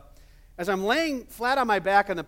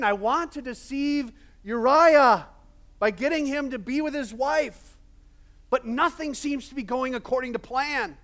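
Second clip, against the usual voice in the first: David scheming; 7 semitones higher, 5 dB louder than usual. Acted.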